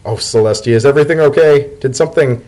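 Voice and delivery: sudden concern in the voice; alarmed. Acted. Leafy fears something is wrong with Dotted, alarmed.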